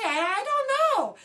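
helpless and flustered. Retold or imitated. Young crew member flustered and unsure when answering Ron. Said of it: "What did he say to say".

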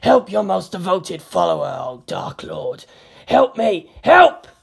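from groveling to screaming for help; desperate plea. A narrator's reading.

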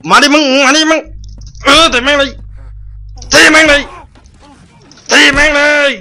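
high, loud panicked cursing; frantic furious yelling. Real, not acted. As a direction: scream curses while fighting for your life. Fighting two enemies in panic. (ตะโกนด่าอย่างบ้าคลั่ง)

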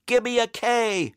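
high-pitched cheerleader chant; peppy, high cheerleading call; shouted cheer. A narrator's reading. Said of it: "shouted the cheerleaders".

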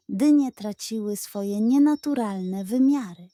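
ordinary delivery, nothing marked

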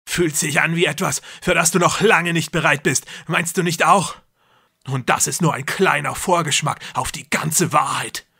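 loud and grandiose, playing a god; deranged brother. A narrator's reading. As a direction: brother lecturing his trapped sister. Jakob taunting sister.

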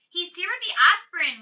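ordinary delivery

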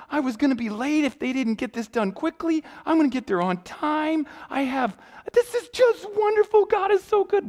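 put-on high, gushing young woman; overjoyed.

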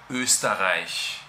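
Österreich with a long Ö, slow; German Österreich. Shown as a demonstration. Teacher pronouncing her home country's name. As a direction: say Österreich slowly. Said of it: "el nombre de mi país natal".

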